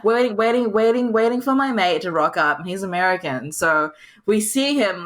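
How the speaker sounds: ordinary delivery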